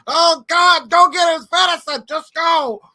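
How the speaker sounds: high, loud panicked yelling; screaming mom. Retold or imitated. Mom sending the child for medicine, frantic screaming. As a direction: imitate a frantic mother screaming orders. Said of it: "Mom Mom was screaming".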